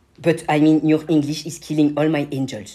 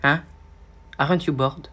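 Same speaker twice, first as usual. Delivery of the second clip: ordinary talk, nothing marked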